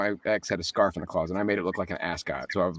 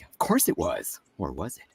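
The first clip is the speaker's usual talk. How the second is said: mock mysterious tease; 4 semitones lower, 3 dB quieter than usual. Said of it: "I'm like".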